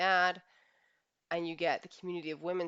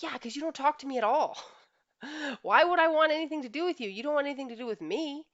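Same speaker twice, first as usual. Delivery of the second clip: higher and louder, exasperated with a laugh; wife snapping back; 9 semitones higher, 7 dB louder than usual. Retold or imitated. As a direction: fire it back at the husband, much higher and louder. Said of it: "And you're like".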